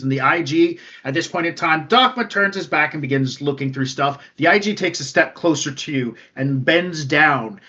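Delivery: usual speaking voice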